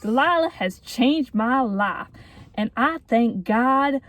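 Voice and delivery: slow, deliberate Southern drawl; Jake's Southern accent. Acted. Reading a boy's text to friend's mom, slow Southern accent. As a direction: read it out in a put-on Southern accent. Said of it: "I could read it and do a Southern accent".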